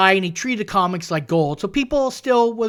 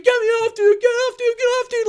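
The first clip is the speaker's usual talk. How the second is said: much higher, mock panicked pleading; panicked high-pitched plea. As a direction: squeal it like a guy desperate to escape. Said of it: "like this".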